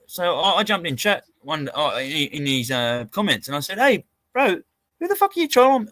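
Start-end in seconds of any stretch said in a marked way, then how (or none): 3.8-5.9 high-pitched affronted question; retold indignant challenge. Retelling how he challenged a troll in chat, indignant. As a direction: call him out, voice pitched right up.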